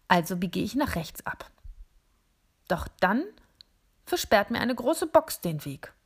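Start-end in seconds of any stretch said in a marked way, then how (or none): none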